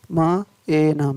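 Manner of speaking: slow, separate phonics sounds; word broken slowly into its sounds; sounding out. Shown as a demonstration. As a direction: say each sound on its own. Phonics teacher sounding out spelling.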